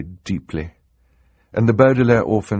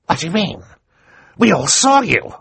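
angry villain; 12 semitones higher, 5 dB louder than usual. Acted. Count Olaf voiced.

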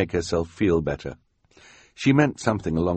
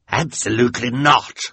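villain voice; 7 semitones higher, 7 dB louder than usual. Acted. Olaf refusing his henchman.

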